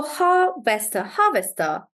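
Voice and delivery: loud harvester, leaning hard on HAR; stress on HAR. Shown as a demonstration. Teacher resuming for the class, harvester stressed loudly on HAR. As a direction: stretch HAR, then let harvester fall. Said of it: "it'll be on the first syllable".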